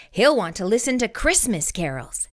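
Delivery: usual speaking voice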